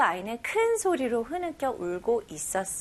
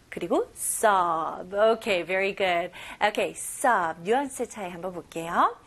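usual speaking voice

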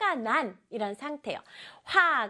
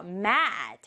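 ordinary talk

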